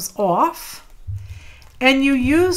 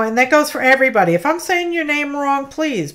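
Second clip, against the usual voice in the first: usual speaking voice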